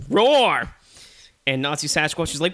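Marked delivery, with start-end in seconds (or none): 0.1-0.7 loud, high roar falling off; bear roar. Acted. Bear challenging his enemy with a loud, falling roar.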